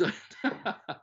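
short breathy laugh; self-mocking little laugh. Real, not acted. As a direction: laugh it off lightly. Laughing at his own age.

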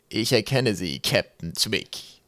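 very slow, measured character voice; figure from above. A narrator's reading. A figure greets Captain Twig from the pipe, measured. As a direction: draw the recognition out slowly.

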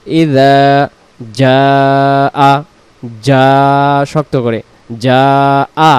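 ইজা জা আ split into slow separate syllables; letter by letter. Shown as a demonstration. Teacher breaking the word into syllables, lightly spaced. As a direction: tap out each syllable of জা আ apart. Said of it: "জিহ্বার আগা থেকে নরম করে".

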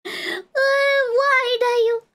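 high, drawn-out tearful whine; mock crying. Streamer showing chat her sad expression, mock crying. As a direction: wail it slowly, pretending to sob.

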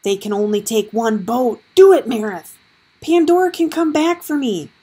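loud urgent shout. A narrator's reading. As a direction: shout over the roaring water. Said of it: "Howard shouted".

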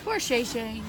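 ordinary talk, nothing marked

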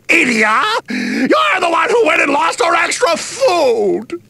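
yelling the accusation; angry shout. Acted. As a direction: snap back and yell at him. Quarrel over lost food.

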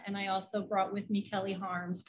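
usual speaking voice

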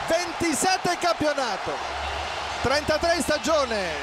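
high ecstatic goal shout; excited goal call. Real, not acted. Mertens fourth goal.

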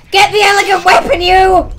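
shouted at an opponent; loud shout. Pretend. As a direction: yell it out. Repeats line, now shouting.

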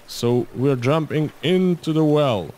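usual speaking voice

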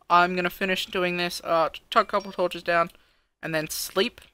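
ordinary talk, nothing marked

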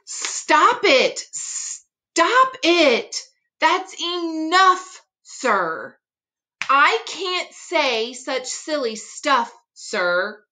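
fed up and begging the fox to quit; exasperated Knox. Acted. Knox protests Fox's tongue twisters.

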